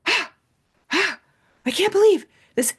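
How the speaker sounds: high, loud squeal of disbelief; shocked angry woman. Retold or imitated. Mocking the woman whose car was robbed: high shrieking disbelief. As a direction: mimic a stranger shrieking in shock. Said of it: "she was like".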